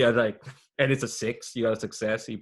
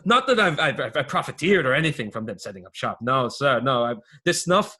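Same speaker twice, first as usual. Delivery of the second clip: put-on accented small-time crook, hesitant and raised; hedging informant underling; 3 semitones higher, 3 dB louder than usual. Underling informs on the Foghounds to his boss, defensively. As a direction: spill the rumour haltingly, then protest your innocence.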